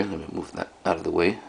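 normal talking voice

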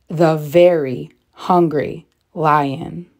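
ordinary delivery, nothing marked

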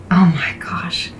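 breathy awed whisper; soft wonder, under the breath; whispered aside. Real, not acted. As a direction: drop to a whisper, amazed. Aside before next story.

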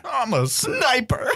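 slow high strained voice; eager recruit squealing; high squeaky reply. Acted. Recruit answering, high cry.

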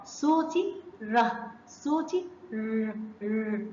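slow, drawn-out ره then a clipped ر; letter sound demo. Shown as a demonstration. Teacher sounding out ر for kindergarten class. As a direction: sound out the ر so children hear it. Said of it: "سامعين الصوت؟".

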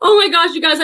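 breathless laugh after running upstairs; panting and laughing after the run; out of breath. Real, not acted. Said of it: "I'm so out of breath".

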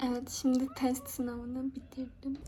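hushed so the mic won't carry; quiet. Real, not acted. Whisper while mic may be live.

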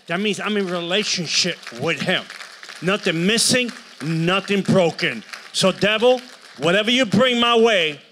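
high, very loud declaration; roaring sermon climax; full-force preaching. Real, not acted. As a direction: thunder it out to the congregation.

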